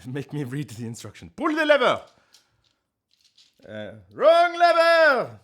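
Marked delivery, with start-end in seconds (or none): 1.3-2.0 much higher, much louder shout; mock command shout. Streamer quoting a villain at chat, loud high shout. 3.7-5.4 much higher, much louder, slow drawn-out yell; mock panicked yell. Streamer finishing the villain quote, high slow loud yell.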